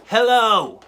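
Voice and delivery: sudden loud, high hammed-up hello; loud mock hello. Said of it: "I just start responding".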